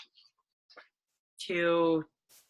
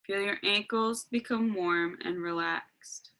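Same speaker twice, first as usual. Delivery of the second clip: guided meditation. Acted.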